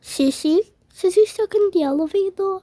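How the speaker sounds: high disbelieving question; shocked question. Acted. Sibling shocked that Sissy is stuck, high disbelief. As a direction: jump up in disbelief.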